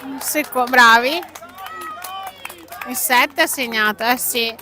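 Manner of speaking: breathless italian goal commentary. Real, not acted. Reacting live to a goal.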